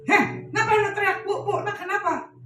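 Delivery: high, loud scolding question; startled retort. Retold or imitated. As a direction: fire back at the one shouting, easing slightly. Acted startled retort to shouting. (menyahut keras dengan kaget)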